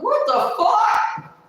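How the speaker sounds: high, slow, drawn-out what the fuck; shocked thought. Retold or imitated. Recalling her arrest, a high, slow, shocked what the fuck. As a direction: let it stretch out in stunned disbelief. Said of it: "I thought".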